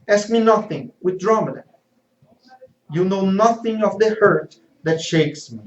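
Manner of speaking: tormented traitor raging at the one who comforts him; clearly aggressive. Acted. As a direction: lash out in anguish and guilt. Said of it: "Clearly aggressive, he says".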